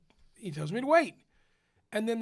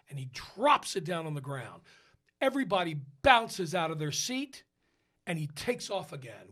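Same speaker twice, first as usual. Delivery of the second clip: ordinary delivery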